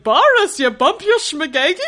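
put-on sudden high, loud shout of Boris, then an old grandmother's scolding; Minka imitation. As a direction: imitate a fussy Yiddish grandma calling her husband. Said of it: "Minka goes like".

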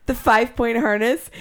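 normal talking voice

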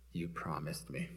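very low, hushed accusation; quiet reproach. Retold or imitated. Husband to wife as the deadline passes, quiet reproach. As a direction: say it under your breath, low. Husband silently fuming at broken promise.

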